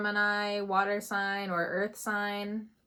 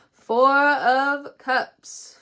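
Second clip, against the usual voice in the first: drawn-out reveal; 5 semitones higher, 10 dB louder, much slower than usual. Real, not acted. Dramatic card reveal.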